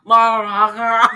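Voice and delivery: loud, mushy "Motherfucker." with a mouthful of sounds; mumbled like eggs in his mouth. Retold or imitated. Mocking Tommy's mangled curse as if his mouth is full of eggs. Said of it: "Sounds like he has, like, eggs in his mouth".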